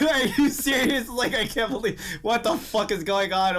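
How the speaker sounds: half laugh, half shout at a dumb twist; laughing disbelief. Retold or imitated. Reading the manga, friend laughs in high disbelief. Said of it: "It's like".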